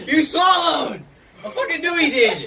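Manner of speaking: shouting through laughter; laughing shout. Real, not acted. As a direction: yell it while cracking up. Friend swallows water, laughing.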